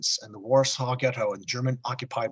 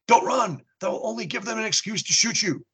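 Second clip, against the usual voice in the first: urgent call; 9 semitones higher than usual. Acted. Clown warns fleeing audience.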